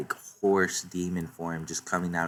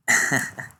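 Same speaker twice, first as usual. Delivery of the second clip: short laugh; 6 semitones higher, 6 dB louder than usual. Real, not acted. Laughs after joke quote.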